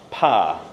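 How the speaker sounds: "pa" for power, a drawn-out open ah; one syllable. Shown as a demonstration. Choir leader telling how the choir sings it, one long syllable. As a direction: say "pa" with no -wer on the end. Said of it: "sing that as".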